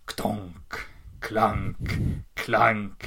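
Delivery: low, heavy clanking spelt out in words; imitated metal footsteps. Heavy thing approaching children in a dark corridor, ominous clanks. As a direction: clank like slow iron steps, spaced apart. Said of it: "metal footsteps".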